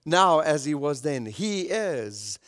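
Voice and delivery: ordinary delivery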